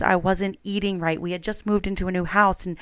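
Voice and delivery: ordinary talk, nothing marked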